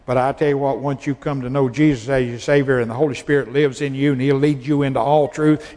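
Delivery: ordinary talk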